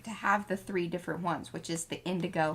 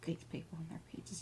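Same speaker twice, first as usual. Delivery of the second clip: whispered aside; 2 semitones lower, 13 dB quieter than usual. Real, not acted. Muttered aside about peach fans.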